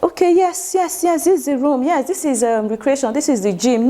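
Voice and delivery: exaggerated, high-pitched chatty call-taker; how not to talk. Shown as a demonstration. Trainer showing staff how not to answer calls, exaggerated and high. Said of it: "You're not expected to talk".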